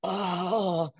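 high, weak, drawn-out rooster moan; mock exhausted rooster cry; tired rooster. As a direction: crow like a worn-out old rooster. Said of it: "it's a lot of, like".

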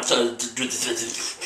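stuttering with cold; teeth chattering. Real, not acted. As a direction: jaw shaking from icy water. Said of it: "I'm shivering".

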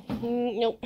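ordinary delivery

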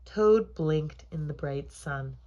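ordinary delivery

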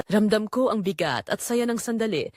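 plain reading voice

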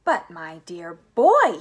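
slow, loud exclamation swelling on the last word; exploding with excitement; excited cry. A narrator's reading. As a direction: cry out with delight. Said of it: "he cried out".

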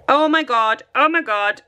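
ordinary talk, nothing marked

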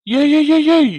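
loud, high repeated hey; husband protesting his wife's new rule, loud and high; alarmed protest. Acted. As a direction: cut her off in mock panic. Husband objects to five years.

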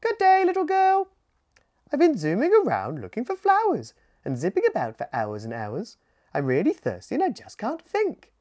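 high, quick chirpy little bird; hummingbird voice. Acted. Thirsty hummingbird greeting the little girl, high and chirpy. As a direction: zip through it like a busy hummingbird.

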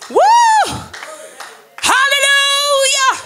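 high, loud shouted praise; ecstatic preaching. Real, not acted. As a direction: let the joy burst out to the church. Preaching climax after laughter.

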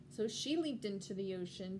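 ordinary delivery, nothing marked

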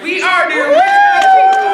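full-force proud shout to the crowd; rallying shout. Real, not acted. Leader declaring who her people are, rallying shout. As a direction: shout it out as the climax.

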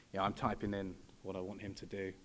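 ordinary talk, nothing marked

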